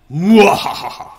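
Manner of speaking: slow loud evil cackle; a gloating villain's laugh; mad scientist cackle. Pretend. Villain laugh as shadows fall.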